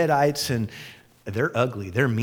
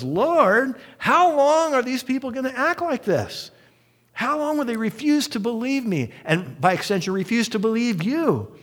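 exasperated lament; 11 semitones higher than usual. Retold or imitated. God lamenting to Moses.

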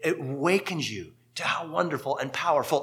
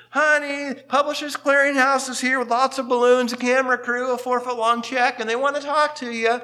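called out over the shoulder, higher and a bit louder; excited shout to the next room; hollering to husband; 8 semitones higher, 4 dB louder than usual. Retold or imitated. Wife calling her husband to the door, hollering excitedly. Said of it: "hollers over her shoulder".

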